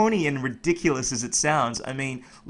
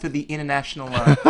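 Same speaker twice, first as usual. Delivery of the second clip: ordinary delivery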